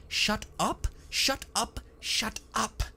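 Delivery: high-pitched yell held back in volume; mock shouting. A narrator's reading. Ignored techie breaking up an argument, mild high yell. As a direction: squeal it as a contained, whiny shout. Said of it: "started shouting".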